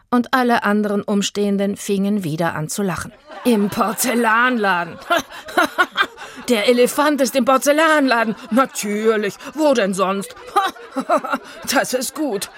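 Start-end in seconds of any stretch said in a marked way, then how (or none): 3.4-12.5 hoch, laut, durch Gelächter gesprochen; lachend. Acted. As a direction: prustend vor Lachen reden. Direktor lacht Itzig aus. (Direktor lacht spöttisch über Itzig)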